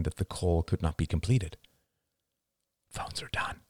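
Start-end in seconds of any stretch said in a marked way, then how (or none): 2.9-3.6 hushed, voiceless whisper; very soft confidential whisper. A narrator's reading. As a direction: whisper it under your breath. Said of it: "whispered Ian".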